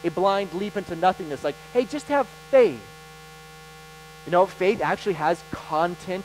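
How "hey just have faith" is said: breezy, higher put-on voice tossing off easy advice; dismissive mock advice. Preacher mocking people who say just have faith, airy and dismissive. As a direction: brush it off like a glib cliché.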